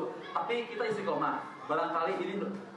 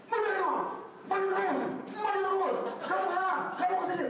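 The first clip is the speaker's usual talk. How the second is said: high mock healer voice, much higher; hammed-up chant of a ruqyah healer; 13 semitones higher than usual. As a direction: mimic the healer scolding the jinn, high and mocking. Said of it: "begitu kalau di Rukyah".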